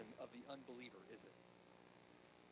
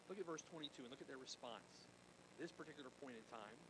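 ordinary talk

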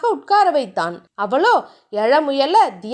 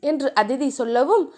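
plain reading voice

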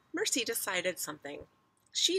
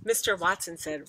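usual speaking voice

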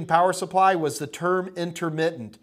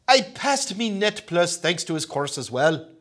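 put-on reading a chat message in a light, playful Irish accent; a hint of a mock Irish brogue on "passed me". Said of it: "That's not a bad Irish accent".

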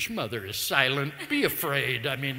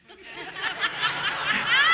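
loud high burst of laughter; 8 dB louder than usual. Real, not acted. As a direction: laugh out at your own joke. Audience laughter at punchline.

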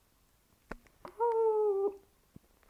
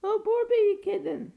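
cooing; 5 semitones higher, 6 dB louder than usual. Real, not acted. Cooing over kitten.

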